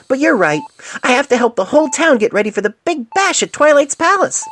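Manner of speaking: very high, loud, bubbly chatter; Pinkie Pie voice. A narrator's reading. Doctor reading Pinkie's letter aloud, high and bubbly. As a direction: burst with giddy excitement. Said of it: "cleared his throat and read aloud".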